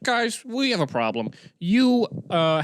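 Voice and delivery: high, put-on voice addressing a crowd; piano player quote. Pianist to the group urging the bride, high put-on announcing. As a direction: call out as the bar's pianist. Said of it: "he was like".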